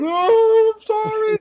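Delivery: very high imitated kid voice; whining child. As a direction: whine an apology like a little kid. Voices his child apologising.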